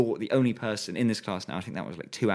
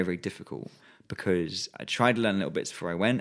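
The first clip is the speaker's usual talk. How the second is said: ordinary talk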